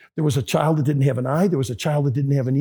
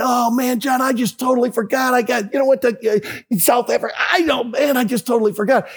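sheepish excited apology; 7 semitones higher than usual. Retold or imitated. Jim apologizing sheepishly, loudly.

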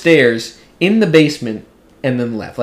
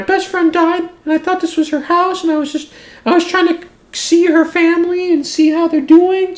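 crying woman. Retold or imitated. Grieving stranger explaining to the homeowner, high and tearful. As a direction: imitate the grieving woman explaining through tears. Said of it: "literally, like, crying".

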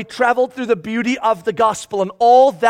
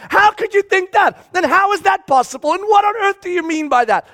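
incredulous Paul; 8 semitones higher than usual. Retold or imitated. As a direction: voice Paul's outraged disbelief at the church. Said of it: "you could just hear him like".

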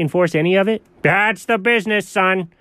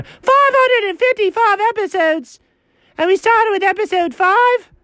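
very high incredulous yell; shrieked comic outrage; outraged outburst. Acted. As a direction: explode in high-pitched disbelief. Outraged repeat of episode count.

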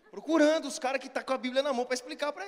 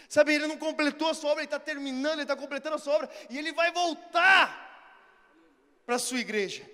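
raised, emphatic promise to the faithful; impassioned preaching; 4 semitones higher, 6 dB louder than usual. Real, not acted. Preacher assuring the church he will return, impassioned. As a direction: proclaim it, swelling on the key promise.

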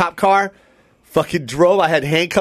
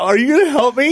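scared plea; 9 semitones higher, faster than usual. Retold or imitated. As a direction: beg the cop for help. Pleading with the cop.

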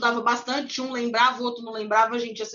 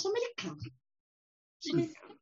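much softer, smug murmured boast; smug quiet aside; 5 semitones higher, 12 dB quieter, faster than usual. Retold or imitated. To herself while chatting with the clerk, smug soft murmur. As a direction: whisper it to yourself like a proud secret. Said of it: "olhava pra cara da pessoa e falava".

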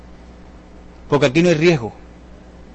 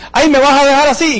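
higher, louder, pleading; hammed-up plea from a prospect; mock whiny prospect; 8 semitones higher, 4 dB louder than usual. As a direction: play the needy prospect, half-joking. Mocking a clingy prospect.

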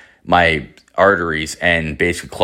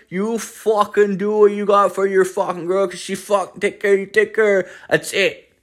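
put-on higher, fast, mumbled Newfoundland accent; thick Newfoundland accent; 11 semitones higher than usual. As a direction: rattle it off in a thick Newfoundland accent, swearing every word.

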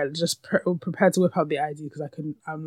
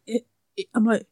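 ordinary delivery

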